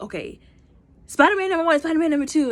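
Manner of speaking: high, loud roll call; bossy callout. Retold or imitated. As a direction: point at each one and call his number out. Character assigning Spider-Men.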